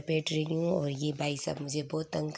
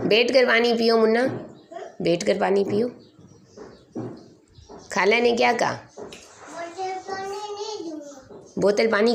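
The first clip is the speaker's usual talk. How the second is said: higher, louder questioning sing-song; 8 semitones higher, 9 dB louder than usual. Real, not acted. Mother quizzing her toddler about the bottles, playful sing-song.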